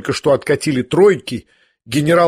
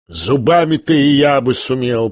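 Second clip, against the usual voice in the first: plain reading voice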